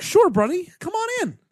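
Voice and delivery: squeaky cartoon pushover; dopey Eric voice. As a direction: play him clueless and overeager. Voicing Eric in the movie.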